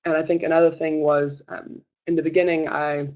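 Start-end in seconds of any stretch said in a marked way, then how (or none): none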